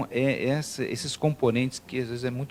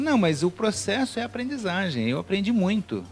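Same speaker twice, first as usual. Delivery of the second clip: higher, lightly amused retelling; retold cheerful answer; 8 semitones higher than usual. Entrepreneur shrugging off a big loss, retold cheerfully. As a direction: quote him brightly, pitched up, without laughing. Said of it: "E ele dando risada e tal".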